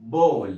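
ball said loud and clear as bol with a falling tune; loud classroom model of ball; model pronunciation. Shown as a demonstration. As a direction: pronounce ball for the class to copy. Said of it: "زي كلمة".

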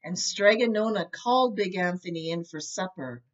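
ordinary delivery, nothing marked

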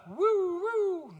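high, sliding siren wail; high siren woo woo; mock police siren.

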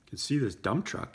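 usual speaking voice

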